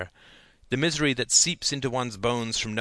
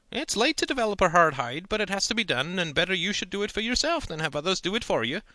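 lecturing uncle; 8 semitones higher than usual. A narrator's reading. Uncle warns nephew about Big City Ladies, folksy voice. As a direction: talk down to the boy kindly.

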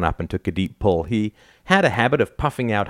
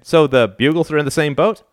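slightly brighter, higher-pitched question; brightening a bit; 8 semitones higher, 4 dB louder than usual. A narrator's reading. Dad hearing his daughters already talked, brightening a bit. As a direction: perk up a little as you ask. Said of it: "said Al, brightening a bit".

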